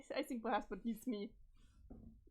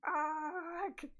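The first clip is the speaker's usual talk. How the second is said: loud, raised-pitch drawn-out "argh"; long exasperated holler; acted yell; 4 semitones higher, 6 dB louder than usual. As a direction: cry out in frustration. Acted cry amid dog-cat fight.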